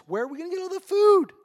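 high, loud exasperated cry; panicked disciples. Acted. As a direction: throw up your hands in disbelief. Disciples panic about feeding crowd.

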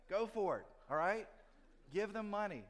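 rally them like a coach at halftime; fired-up urging. Real, not acted. Pastor urging the congregation to give elsewhere, loud excited push.

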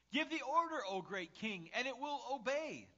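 high, loud urging of a monarch; theatrical adoring crowd; fawning courtier. Acted. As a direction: exclaim grandly, egging the king on. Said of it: "would flatter him all the time".

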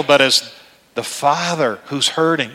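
usual speaking voice

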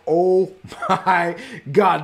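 very slow, stunned acted reaction; drawn-out disbelief, imitating stunned brothers; mock speechless shock. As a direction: draw each word out as if dumbfounded. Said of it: "the brothers were speechless".

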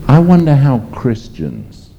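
slow, loud, high preacher's cry; indignant outburst. Real, not acted. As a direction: burst out in disbelief at wealthy believers.

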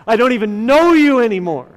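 hurt, furious outburst in a retelling; retold scream. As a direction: act out his angry shout, quick and loud. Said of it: "as he screams".

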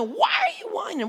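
God cuts in with a big, high rebuke; God stepping in. Retold or imitated. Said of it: "And God goes, literally steps in".

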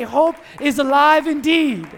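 loud, jubilant preacher's call; ringing proclamation; rallying shout. Real, not acted. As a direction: lead the crowd in a shout. Leads congregation in a shouted response.

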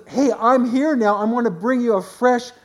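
voicing Jesus with fresh excitement; Jesus announcing himself. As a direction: proclaim it like good news breaking in. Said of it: "And he was".

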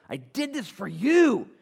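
slow, high and loud proclamation; preacher voicing Jesus at full force; emphatic declaration. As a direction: proclaim it with rising force, drawn out.

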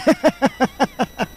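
a short laugh at his own joke; amused chuckle. Real, not acted.

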